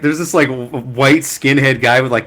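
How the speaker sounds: ordinary talk, nothing marked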